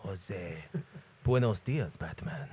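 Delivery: low, hammed-up Spanish accent; mock Spanish accent. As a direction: play a cartoon Spanish villain. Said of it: "with a Spanish accent".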